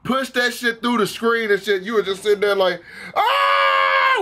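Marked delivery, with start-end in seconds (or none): 3.1-4.2 high-pitched acted scream of shock; mock-frightened shriek; startled yell. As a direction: cry out as if suddenly spooked. Acts out viewer's shock.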